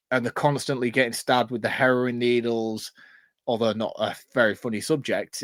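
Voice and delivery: normal talking voice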